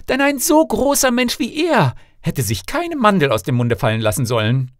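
high-pitched fussy complaint; haughty fault-finding, starting high; scornful princess. Acted. Princess scornfully rejects suitor.

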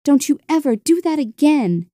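ordinary delivery, nothing marked

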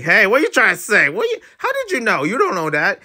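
high, fast put-on voice; squeaky defensive character voice; voicing a flustered webtoon character.

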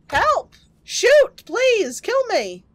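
high, pleading cries for help; desperate begging. Acted. Monster's victim begging to be shot, high desperate plea.

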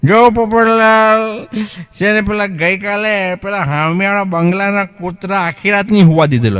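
high, slow, put-on client voice; drawn out, explaining the plan slowly; client's voice. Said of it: "એમ કે". (ધીમો, ઊંચો, બદલાયેલો ગ્રાહકનો અવાજ)

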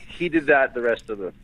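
normal talking voice

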